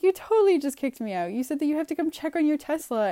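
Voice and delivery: fast indignant accusation. Retold or imitated. Retold argument, accusing him.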